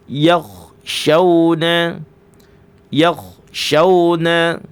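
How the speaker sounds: yakhshawna drawn out with a hissing sh; slow demo. Shown as a demonstration. Tajwid teacher modelling yakhshawna slowly. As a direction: stretch yakhshawna, letting sh hiss. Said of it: "Gitu ya".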